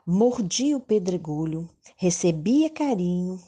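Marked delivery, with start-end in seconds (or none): none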